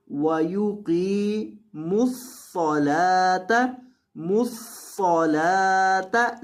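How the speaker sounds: वयुकीमुस्सलाता with thick q and s and la held; heavy s and long la. Shown as a demonstration. Teacher models the word for students, slow chanted recitation. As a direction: draw out वयुकीमुस्सलाता slowly, heavy ss. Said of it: "लाम को लंबा करेंगे एक अलिफ के बराबर".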